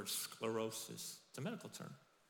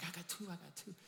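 quick, giddy repeat; two talent servant, eagerly; excited servant; 7 semitones higher, 4 dB quieter than usual. Retold or imitated. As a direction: bounce in with the news. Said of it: "excitedly comes like".

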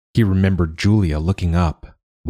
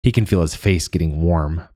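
ordinary delivery, nothing marked

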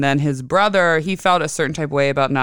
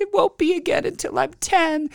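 high child voice, a hint of tears; lightly voiced crying child; 13 semitones higher than usual. Mother imitating her son crying in the bath. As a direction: mimic a sad little boy, pitched high. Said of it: "He's like".

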